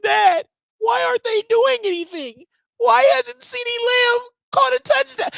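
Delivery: very high, tearful kid imitation; mock crying. Distraught son asking dad about the team, mock crying. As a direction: wail it like a distraught little boy. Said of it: "got tears coming down".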